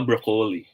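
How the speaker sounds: "broccoli" said as bro-KOH-lee; long O in broccoli. Shown as a demonstration. Teacher tries the other option, long-O broccoli. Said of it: "if it is broccoli".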